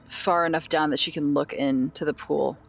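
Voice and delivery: normal talking voice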